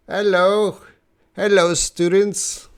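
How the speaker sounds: high, loud, badly faked British accent; attempted British accent. Shown as a demonstration. Teacher greets students in a bad attempt at a British accent. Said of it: "I can't do a British accent".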